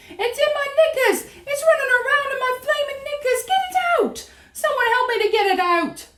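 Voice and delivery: very high, hysterical shriek; screaming. A narrator's reading. Cook with a mouse in his knickers, screaming. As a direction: screech in frantic comic panic. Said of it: "the man was screaming".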